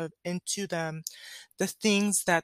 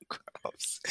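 very soft whispered gross; 12 dB quieter than usual. Real, not acted. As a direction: barely breathe the word. Embarrassed aside after a burp.

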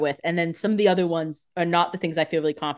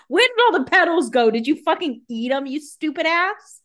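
mock outrage; 9 semitones higher, 4 dB louder than usual. Host scolding a dinky rose, mock outrage. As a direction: berate it in playful, high-pitched indignation.

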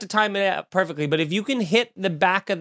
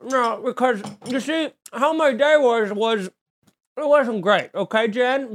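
jaw jutted forward, words round imaginary food; higher, drawn-out bad fake-eating voice; Steve Carell fake-chewing; 6 semitones higher, slower than usual. Shown as a demonstration. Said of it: "he makes his jaw forward".

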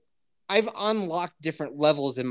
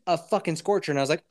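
usual speaking voice

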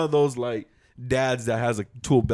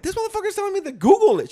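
exasperated retelling; 5 dB louder, faster than usual. Retold or imitated. Venting to wife, retold.

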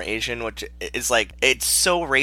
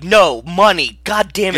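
higher, louder hammed-up outburst; mock frustrated yell; 6 semitones higher, 7 dB louder than usual. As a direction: blow up at the assistant, acting it out. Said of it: "He's like".

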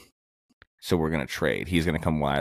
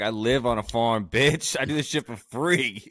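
mock tough farm girl; 7 semitones higher, 3 dB louder than usual. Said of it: "You're like".

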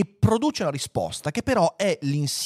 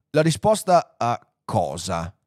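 slow, deliberate questioning; ponderous computer; 2 semitones lower, slower than usual. Acted. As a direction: ask it slowly, as a puzzled machine. Computer Deep Thought questions the men. (computer lento e perplesso)

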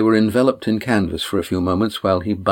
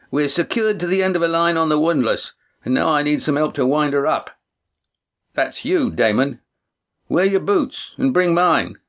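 put-on raised young voice calling ahead; Cam calling out; 7 semitones higher than usual. A narrator's reading. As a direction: call out from the dark shore. Cam calls from the windlass.

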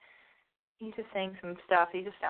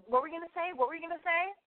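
high, challenging, repeated; confrontational; 10 semitones higher, faster than usual. Retold or imitated. Comedian goading the girl with the eye patch, high and challenging. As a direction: goad her into a fight. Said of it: "I go".

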